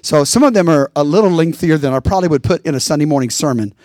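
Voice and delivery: normal talking voice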